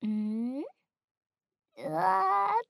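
hammed-up imitation of his cry; mimicking his yelp. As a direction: parrot his startled yelp. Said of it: "とか言って".

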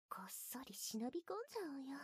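hushed conspiratorial whisper. Acted. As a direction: whisper like sneaking in. Sneaking in secretly.

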